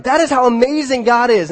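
normal talking voice

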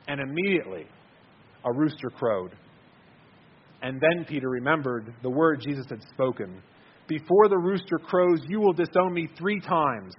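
measured, solemn Bible reading; scripture reading. Acted. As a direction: read the passage like a pulpit reading. Preacher reads Matthew scripture aloud.

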